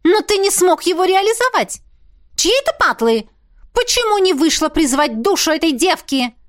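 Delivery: high, loud furious princess; angry princess. A narrator's reading. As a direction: scold him shrilly. Princess angrily scolding.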